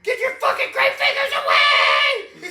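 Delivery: high, loud laughing yell; mock panicked shout. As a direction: shriek at him to back off. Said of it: "You'd be back there with your gun".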